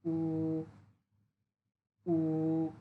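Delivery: উ held level and drawn out; Mandarin first tone. Shown as a demonstration. Teacher shows the first tone on উ, level. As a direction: sustain উ flat. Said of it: "টেনে বলতে হবে".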